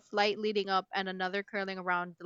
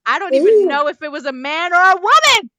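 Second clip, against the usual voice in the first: much higher and much louder, mock-breathless shouting; hammed-up dramatic reading; 8 semitones higher, 16 dB louder than usual. Reading a steamy scene aloud to her friend, mock-passionate shouting. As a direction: overact the steamy memory for laughs.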